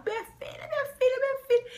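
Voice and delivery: high frantic calling out; dad frantically calling for his daughter; father shouting for his missing daughter; desperate father calling. Retold or imitated. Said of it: "ele fez um escândalo desesperado".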